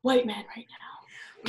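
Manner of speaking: slow whispered punchline; whispered aside. Retold or imitated. Daughter finishes her rant to dad in a slow whisper. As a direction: drop to a slow conspiratorial whisper.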